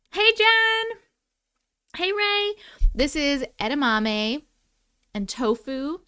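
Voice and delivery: ordinary talk, nothing marked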